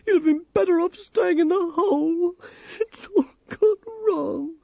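loud, high-pitched tearful wail; distraught, crying protest; sobbing. A narrator's reading. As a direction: wail it through sobs. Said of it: "she sobbed".

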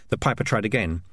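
usual speaking voice

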